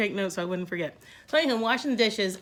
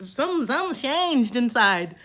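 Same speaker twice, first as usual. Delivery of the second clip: ordinary talk, nothing marked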